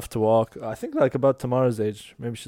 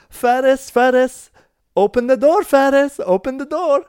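high, loud calling through a locked door; 11 semitones higher, 8 dB louder than usual. Retold or imitated. Nanny locked outside calls to the toddler, loud and high. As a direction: shout for the toddler to let her in.

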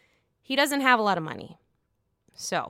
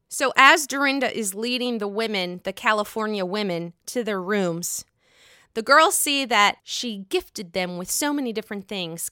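ordinary delivery

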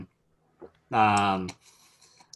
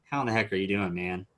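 ordinary talk, nothing marked

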